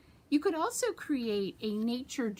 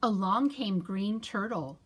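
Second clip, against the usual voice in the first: plain reading voice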